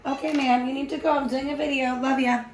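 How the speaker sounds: high-pitched, affectionate shooing; talking to a visitor. Real, not acted. Shooing away a visitor mid-video.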